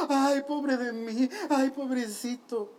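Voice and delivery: slow, whiny ay pobrecito moan; hammed-up poor-me whine; mock self-pity. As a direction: sigh it out like a sulking bench-warmer. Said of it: "quejándonos como el Armando".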